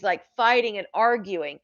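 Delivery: ordinary talk, nothing marked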